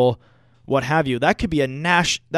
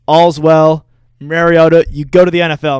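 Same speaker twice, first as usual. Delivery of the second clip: loud, gleeful send-off; gloating shout; 10 dB louder than usual. Real, not acted. Host taunting Oregon's quarterback after the loss, loud gleeful gloating. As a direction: bellow it at the rival team's star.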